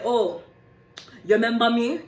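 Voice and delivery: Jamaican patois. Retold or imitated. Man confronting someone on the sidewalk, Jamaican patois. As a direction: imitate a man's thick Jamaican patois. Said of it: "Strong ass patois".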